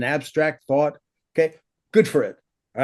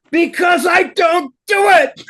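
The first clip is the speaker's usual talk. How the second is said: high, loud, drawn-out yell; emphatic shout; 7 dB louder, slower than usual. Real, not acted. Teacher answering how he knows this, emphatic shout. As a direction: punch each word out at the top of the voice.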